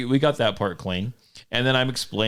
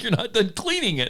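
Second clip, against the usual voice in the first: lightly voiced higher friend's reproach; imitating friend; 10 semitones higher than usual. Friend telling the speaker her gun isn't clean, mild higher mock voice. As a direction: mimic her scolding a bit higher. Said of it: "She's like".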